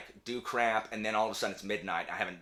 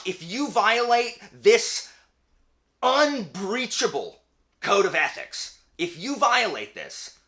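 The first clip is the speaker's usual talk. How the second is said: slow, loud, higher decree; mock solemn warning; 11 semitones higher, 8 dB louder, slower than usual. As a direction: lay down the law, slowly.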